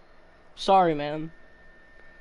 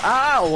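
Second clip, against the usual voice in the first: pained yelp; 7 semitones higher, 5 dB louder than usual. Real, not acted. Stabbed in game.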